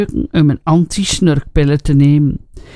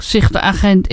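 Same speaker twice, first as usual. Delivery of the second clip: ordinary delivery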